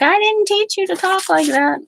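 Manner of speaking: high, scandalized mother voice; mock scolding mom. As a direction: play the shocked mother scolding. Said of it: "She's like".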